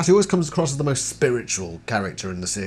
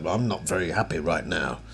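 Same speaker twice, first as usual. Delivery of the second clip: a lower, measured hint of an annoyed father; stern dad voice; 6 semitones lower, 5 dB quieter than usual. Mimicking a fed-up father scolding the kids, lower and restrained. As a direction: imitate a calm dad whose patience is running out. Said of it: "gets, like, that voice on".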